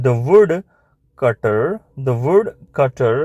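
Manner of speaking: very slow, stretched syllables; slow dictation. Shown as a demonstration. Teacher introducing the woodcutter's reply, slow dictation. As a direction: dictate each word with room to write.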